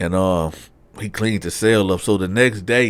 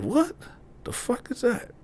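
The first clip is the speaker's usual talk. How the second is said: high, soft, hushed disbelief; a quiet, high what-was-that; hushed startled whisper; 8 dB quieter than usual. Retold or imitated. Hearing a strange noise at night.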